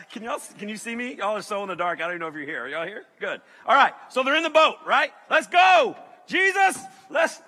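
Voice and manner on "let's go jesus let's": loud excited shout, high. Acted. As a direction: yell it out like rallying the crew. Calling out to Jesus.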